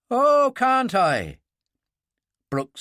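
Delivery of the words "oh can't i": defiant retort. Acted.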